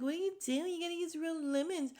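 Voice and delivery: high-pitched imitation of a nagging housemate; mock scolding. Retelling her housemate's lemon complaint, mock scolding. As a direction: put on a high, fussy scolding voice.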